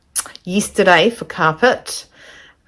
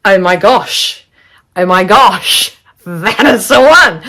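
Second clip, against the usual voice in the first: slow, louder gushing over the carpet; retold excitement; 6 dB louder, slower than usual. Telling viewers about choosing her carpet, slow excited gushing. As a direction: gush like you just found the perfect one. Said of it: "I said".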